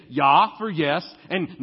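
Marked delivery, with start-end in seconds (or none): 0.1-0.6 "Ja" barked loud and short; German ja. Shown as a demonstration. Pastor mimicking German speakers, loud "Ja". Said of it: "they say".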